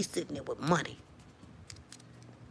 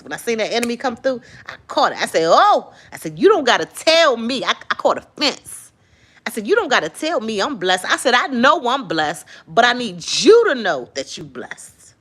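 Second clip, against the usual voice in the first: much louder, higher fervent cry; shouting preacher climax; 6 semitones higher, 12 dB louder than usual. Real, not acted. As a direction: preach it at full force.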